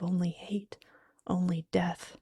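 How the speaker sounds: usual speaking voice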